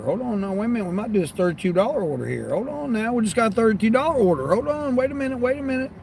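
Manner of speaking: sit up, can't believe the pay; excited double take. Real, not acted. A big order appears.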